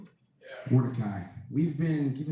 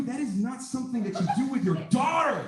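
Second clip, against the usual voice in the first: raised, higher voice, pressing the point hard; emphatic outburst; 7 semitones higher than usual. Real, not acted. Student to classmates about Mordecai, indignant raised voice. Student presenting, stressing outrage.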